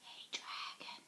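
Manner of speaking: soft slow whisper. Acted. Elizabeth whispering to the sleeping dragon. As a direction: whisper so he doesn't wake. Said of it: "whispered very softly".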